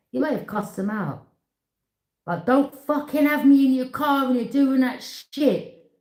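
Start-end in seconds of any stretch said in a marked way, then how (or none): 2.4-5.9 high, loud, slow angry tirade; mock furious cussing. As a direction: tear into them for dragging you along. Acted cussing someone out.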